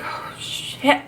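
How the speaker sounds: drawn-out breathy "oh shit" under the breath; mostly breath, hardly any voice; hushed swearing. Retold or imitated. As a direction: let it out as one long, airy exhale. Relief after a panicked phone call.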